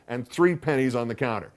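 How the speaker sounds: plain reading voice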